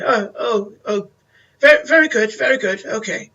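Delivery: put-on high, doddering old man. Old man agreeing to meet the guests, doddering. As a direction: fluster like a frail old gent. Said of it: "He's like".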